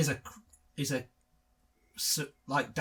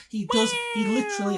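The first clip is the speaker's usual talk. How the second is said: hammed-up sobbing, louder; mock crying; 6 dB louder than usual. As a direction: fake wail of grief. Mock sobbing over rabies.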